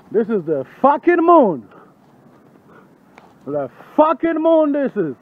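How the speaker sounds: long, high, drawn-out yell; shouting. Pretend. Night walker proclaiming the moon to the street, shouting. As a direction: bellow it slowly, stretching the big word. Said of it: "אל תצעק".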